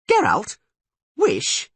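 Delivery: high, loud startled questions; shocked. Acted. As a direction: blurt it in shock. Dandelion shocked.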